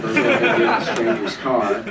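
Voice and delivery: ordinary talk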